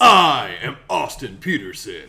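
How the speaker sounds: booming, slow, commanding; authoritarian voice. Acted. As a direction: declare it like a dictator. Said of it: "That was very authoritarian of you".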